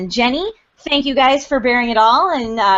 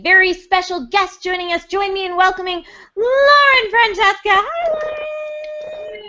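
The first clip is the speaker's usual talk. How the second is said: excited guest introduction; 10 semitones higher than usual. Real, not acted. Host welcoming a special guest on the show, excited. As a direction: build to a long high squeal of welcome.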